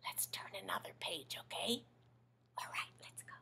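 soft conspiratorial whisper. Acted. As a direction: share a secret with the kids. Reader whispers to kids.

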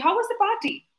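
high-pitched, lightly voiced mother's question; asking mother. Teacher voices a mother asking after the party, high-pitched question. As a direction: ask it high, as a curious parent would. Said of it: "your mother asks you".

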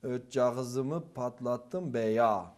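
put-on very slow Ötcağızımı in a botched Thracian accent; mixed eastern-Thracian accent. First-time actor reads Hallaç's line in mixed accent. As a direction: eastern accent leaking into a Thracian one, drawn out. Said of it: "diye okuyorum".

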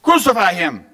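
high, loud crowd cry. A narrator's reading. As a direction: shout it as a mob demanding a sentence. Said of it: "they kept shouting even louder".

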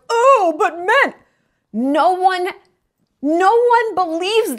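hoog en traag zeurend; mocking whiny man. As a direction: doe een klagende man spottend na. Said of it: "men who are like".

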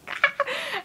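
high laughing squeal; excited squeal. Retold or imitated. Kid wiggling over free tabs, excited squeal. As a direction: giggly squeal of retold glee. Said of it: "I was, like, wiggling".

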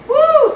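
sudden high, loud whoop; excited whoop. Real, not acted. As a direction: let out a short whoop of joy. Preacher's whoop on finding scripture.

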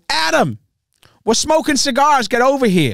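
high, hammed-up American comic calling over; Bill Burr impression. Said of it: "Bill Burr goes".

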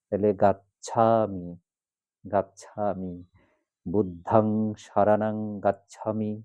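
গাচ্ছামি with open aa, slow; গ becomes গা, গাচ্ছামি said gachchhami; slow demo of the corrected chant; correct Pali a vowel. Shown as a demonstration. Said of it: "গ থেকে গা".

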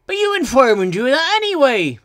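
high, loud protest; indignant engine piping up; objecting. A narrator's reading. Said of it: "objected Ben".

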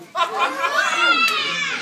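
a high, loud burst of delighted laughter; gleeful laugh. Real, not acted. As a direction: cackle with glee at the thought of the race. Coach teasing about racing kids.

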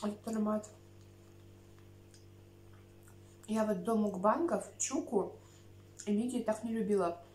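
chewing between words; talking while eating salad; mouth full of seaweed. Real, not acted. Seen 1: Lifts seaweed to mouth repeatedly, chewing between phrases throughout.

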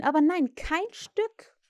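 usual speaking voice

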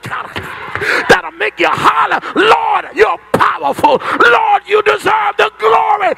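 shouting, high-pitched preacher crying out for fire; fiery preaching. Acted. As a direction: preach it full force, calling down fire. Preacher building to climax.

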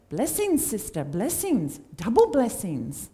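badly imitated accent with leaping pitch; Hugo's accent. Preacher imitating a former fellowship member, in a bad accent. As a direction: punch each blessing in a terrible put-on accent. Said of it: "I'm doing the accent, that's terrible".

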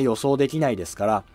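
ordinary talk, nothing marked

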